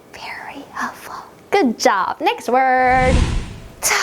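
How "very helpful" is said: whispered prompt, very soft. Shown as a demonstration. Teacher prompting pupils to repeat the phrase, whispered. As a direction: whisper it slowly, just under the breath.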